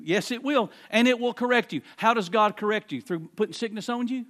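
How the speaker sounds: ordinary delivery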